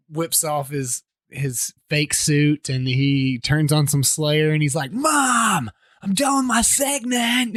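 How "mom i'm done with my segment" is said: high-pitched imitation of yelling to Mom; mock holler through the house; calling to Mom. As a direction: whine it up high like a kid. Retold character calls to his mom.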